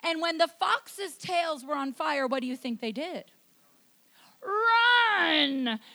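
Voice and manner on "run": sudden loud, high shout; explosive preacher's yell; shouted exclamation. Acted. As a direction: yell it out long and hard. Answers own question, shouting.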